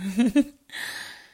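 airy whispered laugh, loud on the mic; a close, teasing giggle; breathy laugh. Acted. Giggle between lines.

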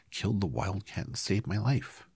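lightly voiced high mouse queen; a hint of small queenly creature. A narrator's reading. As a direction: pitch it up a touch, royal mouse. Mouse queen answers her subjects.

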